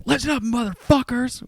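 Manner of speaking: high, loud furious yell at the roof; retold enraged driver shouting; angry yell. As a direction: bark it up at hidden kids. Angry driver yelling at pranksters.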